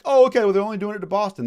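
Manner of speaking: high, loud, fast airy voice; mock dismissive colonist. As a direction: breeze through it like you're unbothered. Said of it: "the other colonies aren't like".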